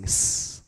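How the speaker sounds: soft hissed tss; beatbox hi-hat. Shown as a demonstration. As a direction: hiss it out like a hi-hat. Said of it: "like you're hissing".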